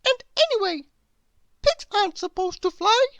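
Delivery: high squeaky little bird voice; very high small bird voice; Chirp the bird. A narrator's reading. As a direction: pipe it up high like a tiny bird.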